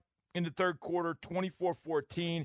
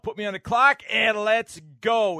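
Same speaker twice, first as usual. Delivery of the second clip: radio host intro; 5 semitones higher, 5 dB louder than usual. Acted. Segment intro over music.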